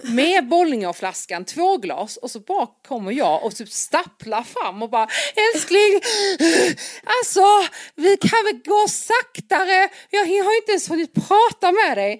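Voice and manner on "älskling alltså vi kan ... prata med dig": high, loud, slowed whine to darling; a put-on, much higher and louder plea; pleading girlfriend. Stumbling after him uphill.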